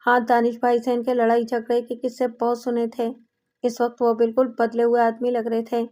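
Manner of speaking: ordinary delivery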